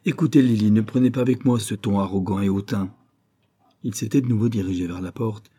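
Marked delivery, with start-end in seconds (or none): 0.0-2.9 angry rebuke. Acted. Stung by her irony, Gus snaps "Écoutez, Lily" angrily. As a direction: scold her sharply for her haughty tone. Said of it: "marques de colère".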